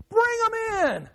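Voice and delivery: high, slow mock-naive sneer; mimicking those who want open borders, high and slow. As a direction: drag it out like the gullible crowd would. Mocking naive open-door attitude.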